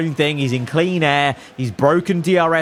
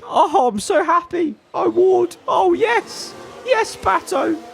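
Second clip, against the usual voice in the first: overjoyed cheering; 13 semitones higher, slower than usual. Real, not acted. Overjoyed at his driver's pass.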